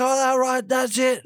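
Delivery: high mock huff; mocking huffy coworker. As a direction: play the coworker storming off in a squeaky voice. Coworker storming off, mocked.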